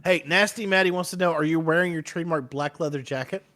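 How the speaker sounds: normal talking voice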